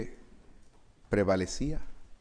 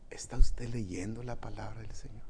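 whispered appeal; 3 semitones lower than usual. Acted. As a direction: whisper it straight to their conscience. Intimate appeal to conscience. (pregunta susurrada a la conciencia)

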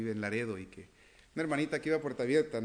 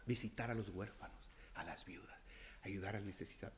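soft low emphasis; 4 semitones lower, 10 dB quieter than usual. Acted. Lowered intimate repetition.

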